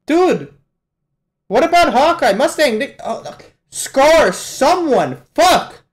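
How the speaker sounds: high, urgent yelling at the screen; frantic plea. Real, not acted. Viewer begging anime characters to help Hawkeye, frantic plea. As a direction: shout in rising alarm for anyone to step in.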